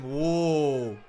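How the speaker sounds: ordinary delivery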